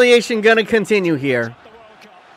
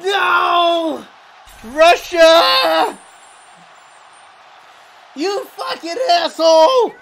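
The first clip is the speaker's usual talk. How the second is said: high, drawn-out screams of outrage; real fan meltdown; anguished yelling; 12 semitones higher, much slower than usual. Real, not acted. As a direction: wail each phrase at the top of your range. Anguished reaction to Russia match.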